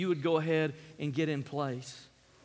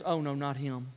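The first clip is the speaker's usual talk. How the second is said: muted, hammed-up dismay; mock moan; 4 semitones lower than usual. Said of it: "you get this moan".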